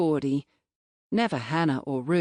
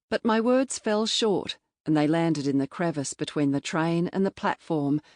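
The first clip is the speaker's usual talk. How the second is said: ordinary delivery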